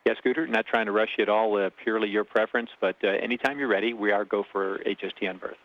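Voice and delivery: fast, crackly radio chatter; tinny, compressed space radio; breaking up space radio. Real, not acted. Shuttle to ground radio call.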